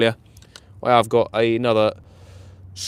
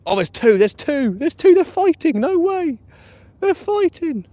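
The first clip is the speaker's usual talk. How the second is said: excited; 5 dB louder than usual. Real, not acted. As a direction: voice climbing much higher with each line, ending shrill. Two rats suddenly fighting on camera.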